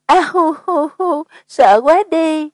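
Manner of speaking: high, drawn-out mock boo-hoo; fake scared wailing. Woman hastily plays along when asked if she's scared, mock wailing. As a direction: play along with a pretend frightened wail. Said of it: "vội kêu lên phối hợp".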